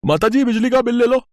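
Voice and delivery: high-pitched delivery man calling; electricity bill man. Acted. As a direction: call out at the door.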